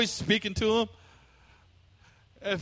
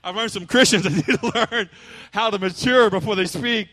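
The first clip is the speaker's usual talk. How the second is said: said again louder, with a laugh; preacher repeating the jab louder with a laugh; louder restated point; 2 semitones higher, 6 dB louder than usual. Real, not acted. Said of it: "Let's get back over here".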